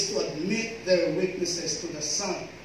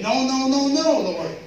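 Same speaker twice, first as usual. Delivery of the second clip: loud, higher repeated no; emphatic refusal; 5 semitones higher, 6 dB louder than usual. Acted. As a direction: hammer each no as Peter. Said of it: "It's very emphatic".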